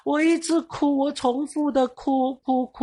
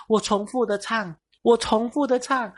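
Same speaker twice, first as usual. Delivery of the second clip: ordinary delivery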